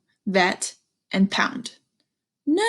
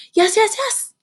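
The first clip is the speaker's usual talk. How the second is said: much higher, bouncing yes; high, louder, eager little yeses; eager dog; 12 semitones higher, 4 dB louder than usual. Acted. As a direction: yip it out like an excited puppy.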